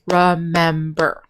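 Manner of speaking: slow, careful remember, every syllable distinct; syllables spelled out slowly. Shown as a demonstration. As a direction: stretch remember into its three syllables. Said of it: "that's three syllables".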